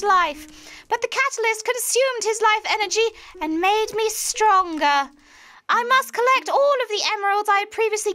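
high, theatrical mad witch reading her log. Pretend. Reading the villain's log to friends in a witch voice. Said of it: "do your witch voice".

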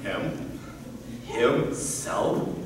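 low, hushed questioning; stunned disbelief. Acted. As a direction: echo it softly, unable to believe it. Father stunned by suitor's announcement.

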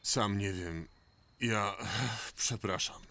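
low, soft and sheepish; sigh, then apologise quietly; subdued, at a loss, voice dropped low; dejected apology. Acted. Failed rescuer apologises, bewildered.

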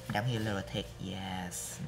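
ordinary talk

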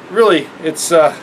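normal talking voice

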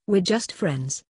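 ordinary talk

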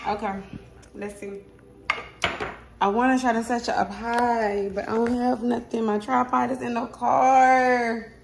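ordinary talk, nothing marked

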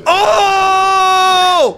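sudden high loud cry; startled shout. Real, not acted. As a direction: jolt at a sudden fright. Jump scare in game.

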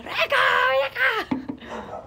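high, slow drawn-out call across the yard; calling the dog. Retold or imitated. Owner calling her dog Rebeca, high and drawn out. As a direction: stretch it out, calling a dog from afar. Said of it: "Y yo empiezo".

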